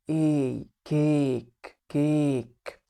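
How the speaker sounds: "كيك" said with a long ay; long a in cake. Shown as a demonstration. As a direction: stretch the ay in "كيك". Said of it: "تركز على حرف ال A".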